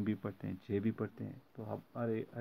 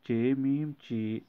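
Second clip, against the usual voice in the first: ordinary talk, nothing marked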